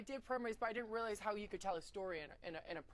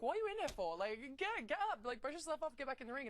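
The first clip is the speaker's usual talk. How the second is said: higher-pitched retold exasperation; impatient scolding; 6 semitones higher than usual. Looking at injured girls at training, impatient scolding. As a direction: chivvy them along in a raised voice.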